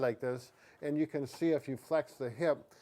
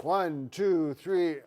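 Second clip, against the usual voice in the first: loud lifting count; 3 semitones higher, 5 dB louder, much slower than usual. Real, not acted. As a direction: call the count to lift together. Counting for a lift.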